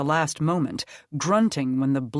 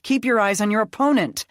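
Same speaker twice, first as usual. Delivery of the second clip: fast, loud, higher scolding; snapping angrily; 7 semitones higher, 6 dB louder, faster than usual. A narrator's reading. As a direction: order him sharply to focus. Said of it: "Krista snapped".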